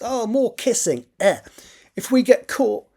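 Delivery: put-on high, slow, anxious teenage boy; nervous young boy. Nervous boy voiced in story.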